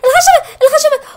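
high, loud frightened repeats of الخشبة; panicked cry. Real, not acted. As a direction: shriek الخشبة as it breaks.

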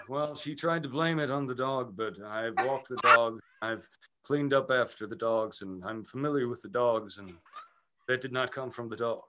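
put-on low, soft, measured Depp voice with a French accent; Johnny Depp impression. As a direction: do Depp testifying, hushed and deliberate. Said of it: "he's like".

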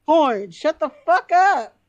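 high, loud, drawn-out telling-off; exasperated scolding. Acted. Woman hauling her drunk friend past the barman, exasperated scolding.

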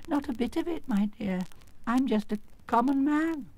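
high, timid confession; meek little man. Acted. Exposed wizard confessing to a girl, meek. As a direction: admit it in a small high voice.

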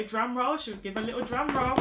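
fast excited drum roll tease; playful build-up. Real, not acted. Building suspense for hero.

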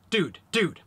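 fast, clipped pair of dudes; quick dude. Shown as a demonstration. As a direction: fire them off quickly. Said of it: "or something maybe quick".

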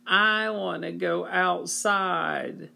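high, slow whine; whining child. Acted. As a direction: whine like a bored kid. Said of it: "whined Henry".

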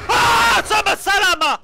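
startled shouted outburst; scared shout. Real, not acted. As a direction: shout in fright. Jump scare.

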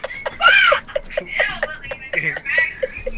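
very high shrieking laughter. Real, not acted. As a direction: laugh so hard it turns into a shriek. Teammate laughs at the kill.

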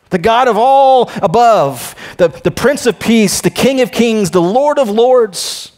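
preacher building to a high, ringing declaration; emphatic preaching. Real, not acted. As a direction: proclaim each title with rising force. Preaches at full force.

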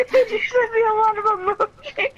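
high squealing laugh through words; laughing shout. Real, not acted. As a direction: shriek it while laughing. Laughing retelling chats.

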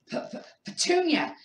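stammered high gasp of shock. A narrator's reading. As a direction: choke out her name in panic. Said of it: "he gasped".

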